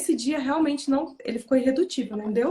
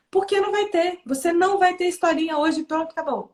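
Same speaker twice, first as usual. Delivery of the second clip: raised pitch, dismissive parental refusal; curt strict parent; 6 semitones higher, 4 dB louder than usual. Acted. Parent refusing bedtime story outright, high and brusque. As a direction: shut the child down with no explanation.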